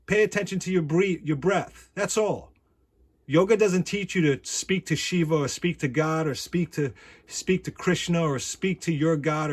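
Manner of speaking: ordinary delivery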